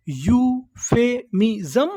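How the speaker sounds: "Euphemism" very slowly in separate beats, rising at the end; broken into syllables. Shown as a demonstration. Teacher repeating the word for learners, syllable by syllable. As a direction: stretch "euphemism" out piece by piece. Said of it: "टुकड़ों में तोड़-तोड़ के पढ़ना है".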